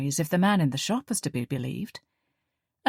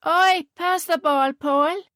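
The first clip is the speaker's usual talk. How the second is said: put-on high, slow Northern Ireland kid calling out; child's Northern Ireland accent; 11 semitones higher, 5 dB louder, slower than usual. Child calling to a friend across the street, Northern Ireland accent. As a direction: shout it like a local Northern Ireland child at play. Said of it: "their strong Northern Ireland accents".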